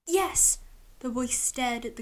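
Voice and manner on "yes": much higher, louder yes; quick bright child reply; eager little boy. A narrator's reading. Boy answers the inquisitor, higher child voice.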